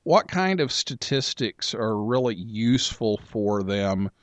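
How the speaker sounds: usual speaking voice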